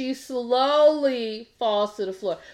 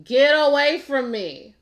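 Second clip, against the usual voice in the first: louder, higher imitation of a screamer; retold mock scream; 4 semitones higher, 6 dB louder than usual. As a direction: mimic her screaming, half-hearted but forceful. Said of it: "all while screaming".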